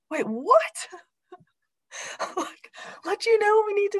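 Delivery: high, incredulous double take; surprised disbelief. Retold or imitated. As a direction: react in stunned disbelief. Surprised at son's words.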